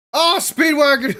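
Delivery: high, loud delighted shout of his name; excited cry. Real, not acted. Viewer spotting Speedwagon on screen, delighted shout. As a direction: greet him like a returning hero. Sudden cry on seeing a favourite character.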